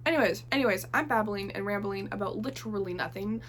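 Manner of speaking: usual speaking voice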